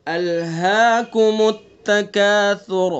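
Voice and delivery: teacher's corrected recitation; model recitation. Shown as a demonstration. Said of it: "سا پڑھنا ہے۔".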